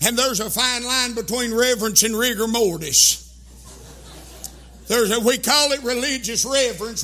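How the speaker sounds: loud, driving sermon cadence; fiery preaching. Acted. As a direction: preach it hard to the congregation. Preaching at full force.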